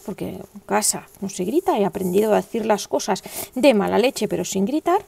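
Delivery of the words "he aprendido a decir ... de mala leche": usual speaking voice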